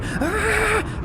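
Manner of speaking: very high imitated shriek; mock scream. Host re-doing the remembered scream, very high. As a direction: shriek like the exorcised demon. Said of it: "Remember the scream?".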